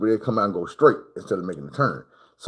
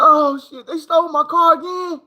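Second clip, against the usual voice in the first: high, loud startled mama; just woke up, car gone again; groggy mama outraged; 4 dB louder than usual. Retold or imitated. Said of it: "She was like".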